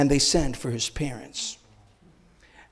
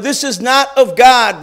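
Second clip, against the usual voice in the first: loud, higher, slow condemnation; angry declaration; 6 semitones higher, 7 dB louder, slower than usual. Retold or imitated. Pharisees to the healed man, enraged and loud. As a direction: condemn him with rage. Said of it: "This enraged the Pharisees".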